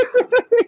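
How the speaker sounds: cracks up at his own comeback; real hearty laugh. Real, not acted. Retelling the phone call, laughing hard.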